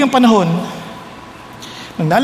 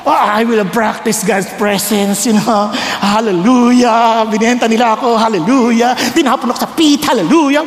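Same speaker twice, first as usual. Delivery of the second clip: mock pious; 2 semitones lower than usual. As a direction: intone it like a serene worshipper, drawing out each Hallelujah. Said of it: "Walang ganun".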